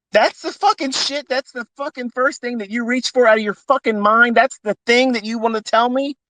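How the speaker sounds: high-pitched, worked-up outrage; indignant rant. Real, not acted. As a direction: push it up high, fed up. Venting anger at uncle.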